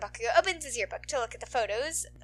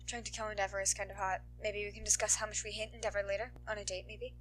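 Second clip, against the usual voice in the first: soft, fast murmured flirt; quiet flirting; 3 semitones lower, 9 dB quieter than usual. Acted. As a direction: murmur it like a private aside. Overheard flirting whisper.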